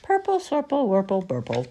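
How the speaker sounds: high playful nonsense chant; singsong it like a giggling child; giggly silly rhyme. Acted. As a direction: Roo rhyming playfully. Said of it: "and he giggled".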